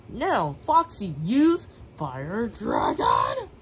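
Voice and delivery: loud, slow battle shout; attack call. Acted. Gamer calling out the special move, a slow loud shout. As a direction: bellow the move name.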